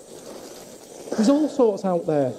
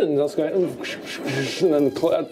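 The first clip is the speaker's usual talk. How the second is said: normal talking voice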